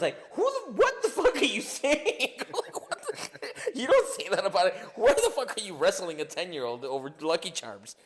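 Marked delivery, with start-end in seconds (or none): 0.3-2.3 very high yell breaking into laughter; Kevin Hart disbelief. Retold or imitated. Imitating Kevin Hart's disbelief. 2.5-6.8 high breathless laughing words; laughing squeal. Retold or imitated. Laughing imitation.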